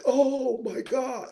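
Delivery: high, slow drawn-out gasp; mock gasp. Host mimicking characters in slow motion, mock gasp. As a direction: stretch out a dramatic oh my God. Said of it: "characters are like".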